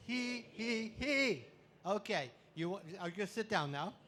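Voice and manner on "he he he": higher, loud he he he; leading the standing crowd in laughter yoga, high loud giggle. Shown as a demonstration. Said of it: "we're gonna start down here".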